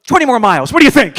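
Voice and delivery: loud and teasing; playful dare. Retold or imitated. Wife teasing him with an empty tank, loud and gleeful. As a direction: toss out the dare with glee. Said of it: "and then go".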